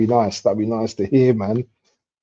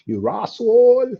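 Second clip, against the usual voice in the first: high, drawn-out mock Bajan; hammed-up high Bajan imitation; Bajan accent; 13 semitones higher, 4 dB louder than usual. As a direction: exaggerate the Bajan accent, pitched right up. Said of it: "They say that".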